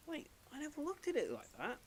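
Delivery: high, soft dawning surprise; mock realisation. Player reading a hint, suddenly amazed. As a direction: play a sudden puzzled discovery.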